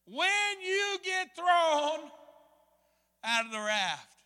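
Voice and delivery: "When you get thrown" drawn out slow, high and loud, then "out of the raft" eases down; ringing echo. Retold or imitated. Preacher replaying the guide's warning as a loud, slow echo. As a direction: call it out like a memory ringing through the head. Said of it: "I hear an echo in my mind".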